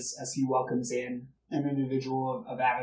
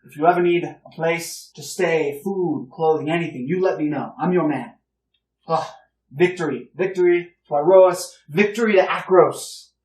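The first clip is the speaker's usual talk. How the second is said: put-on loud, higher, hearty old officer gushing; a blustering proud old soldier, louder and higher; older gentleman greeting the heroes, loud and hearty; boisterous older gentleman; 3 semitones higher, 6 dB louder than usual. Old gentleman offers help, cheers.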